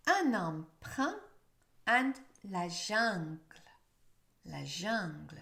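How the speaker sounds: "Brun", "aucun", "chacun" with a prominent nasal vowel; French word list. Shown as a demonstration. Teacher reading the word list for learners. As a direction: model each word with a slow nasal vowel. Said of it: "The vowel sound should be more prominent".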